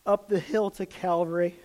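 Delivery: ordinary delivery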